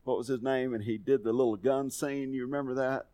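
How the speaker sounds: ordinary talk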